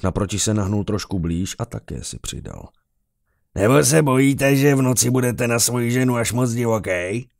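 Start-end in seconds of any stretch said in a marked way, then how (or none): 3.5-7.3 loud, high jeering taunt; mocking taunt. A narrator's reading. Drinker teasing the officer about his wife, loud mocking taunt. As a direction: tease him loudly, high-pitched and boozy. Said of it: "Poslední slova vyprskl v záchvatu smíchu".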